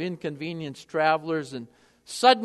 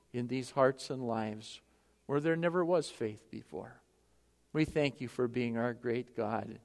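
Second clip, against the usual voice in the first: softer, low-key prayer voice; closing prayer; 4 semitones lower, 5 dB quieter than usual. Acted. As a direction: pray it humbly and gently.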